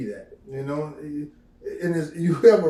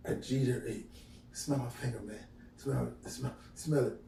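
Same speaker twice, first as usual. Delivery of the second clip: put-on a quiet sly brag about a girl; muttered boast; 7 dB quieter than usual. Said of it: "he would say".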